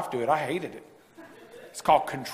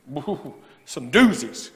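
drawn-out woo; 5 semitones lower, much slower than usual. Real, not acted. Drawn out exclamation.

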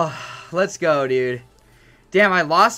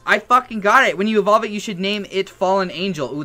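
normal talking voice

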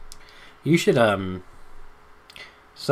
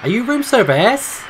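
high, loud, slow mock-polite question; 10 dB louder than usual. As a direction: ask the monster sweetly, teasing. Asking monster mockingly.

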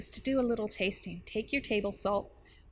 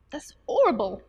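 loud, higher mock English accent; terrible English accent; 3 semitones higher, 8 dB louder than usual. Teacher quoting a friend on table salt, in a terrible English accent. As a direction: put on a bad English accent. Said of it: "that's my terrible English accent".

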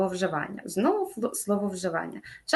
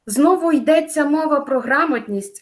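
louder, higher on "Знову йдеться мова про грамотність"; wrong form held up; 6 semitones higher, 5 dB louder than usual. Shown as a demonstration. Teacher shows students an impossible phrase, higher and louder. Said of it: "абсолютно неможлива форма".

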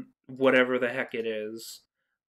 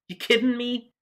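high, disbelieving outburst; can't believe what people did; incredulous; 8 semitones higher than usual. Real, not acted. Outraged at people's reaction.

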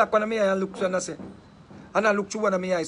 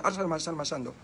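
soft, low, quick run of fake tongues; hushed private practice, low and quick; fast mental rehearsal; 4 semitones lower, 8 dB quieter, faster than usual. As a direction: rattle it off under your breath. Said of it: "me I say it fast".

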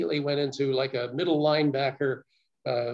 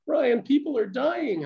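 slow, much higher retold tearful plea; in tears; 11 semitones higher, 3 dB louder than usual. Coworker telling Brian people are dying, slow tearful plea. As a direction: echo her tearful panic, drawn out. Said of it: "in tears, she said".

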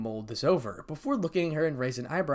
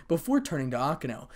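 plain reading voice

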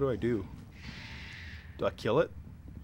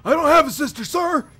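shouting recruit; 13 semitones higher, 8 dB louder than usual. Pretend. Recruit answering drill sergeant.

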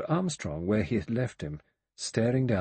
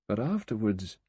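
usual speaking voice

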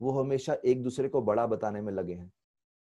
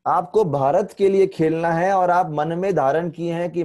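louder, firm scolding; stern coach; 4 semitones higher, 6 dB louder than usual. Retold or imitated. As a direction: lecture the team firmly. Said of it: "Shahrukh Khan बोलते हैं".

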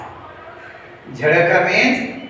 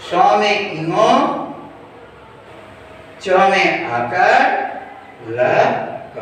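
slow spelling out; much slower than usual. Shown as a demonstration. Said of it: "teacher spells word slowly".

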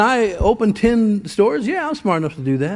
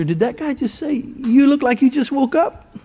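higher-pitched, drawn-out echo of disbelief; incredulous quote; 4 semitones higher than usual. Retold or imitated. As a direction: repeat it slowly, pitched up, as if you can't believe it. Companion shocked at the remark.

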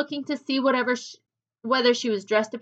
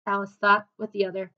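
ordinary delivery

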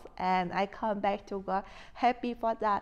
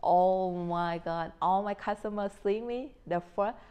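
ordinary talk, nothing marked

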